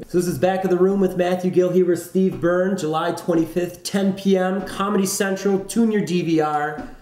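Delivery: announcer. Acted. Host reads show outro.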